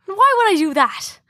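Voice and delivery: Irish outlaw. Acted. Outlaw rebuffing his hostage's request, in Irish accent. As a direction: question him in a put-on Irish accent. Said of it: "I'm usually good at the Irish accent".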